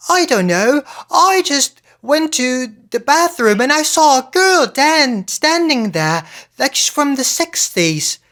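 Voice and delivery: high, loud, mock British accent; overdone British accent. Podcaster mimicking a film actress from a commercial, overdone British accent. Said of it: "accent that sounds so fake".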